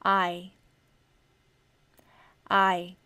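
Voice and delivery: आई said slowly as a full eye glide; long i sound. Shown as a demonstration. Teacher modelling आई for her students. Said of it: "फिर है long आई का sound".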